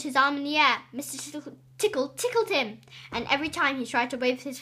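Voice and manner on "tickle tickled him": usual speaking voice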